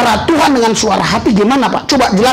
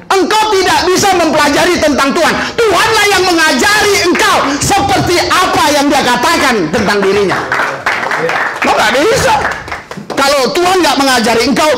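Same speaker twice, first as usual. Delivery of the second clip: full-force preaching; 8 semitones higher than usual. Acted. Preacher tells the church about God, fervent. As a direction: proclaim it to the congregation.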